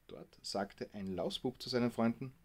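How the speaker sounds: ordinary delivery, nothing marked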